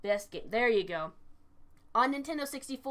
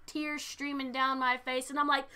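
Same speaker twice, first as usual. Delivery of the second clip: usual speaking voice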